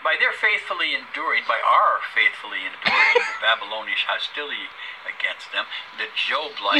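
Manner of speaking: loud preaching read. Acted. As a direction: proclaim it from the pulpit. Reads scripture aloud, preaching.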